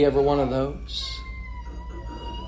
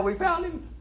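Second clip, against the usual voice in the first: very high, squeaky little cry of discovery; mock excited. The crowd on finding Jesus, imitated as excited. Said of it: "They're all excited now".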